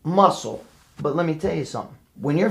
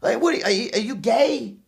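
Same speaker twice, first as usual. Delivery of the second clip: higher, scornful dad questioning; mocking father; 9 semitones higher than usual. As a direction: sneer it like a disbelieving dad. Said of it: "my father'd be like".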